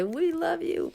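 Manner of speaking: high, put-on fan voice; gushing guests. Wedding guests begging tired band to stay, high mock gushing. Voicing guests who want more music.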